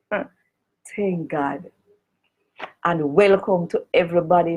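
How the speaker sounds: usual speaking voice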